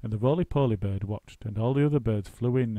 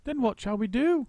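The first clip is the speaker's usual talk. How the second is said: high, chattering monkey; a monkey's quick squeaky voice; Muggle Wump; 13 semitones higher, 3 dB louder than usual. A narrator's reading. Muggle Wump questioning the roly-poly bird. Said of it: "cried Muggle Wump".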